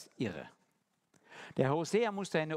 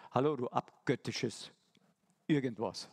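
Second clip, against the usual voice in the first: lower, fast, faintly scolding; imagined reproach, lower and quicker; mock scolding; 4 semitones lower than usual. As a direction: play a God who nags his wayward child. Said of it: "das nächste Mal sagt er".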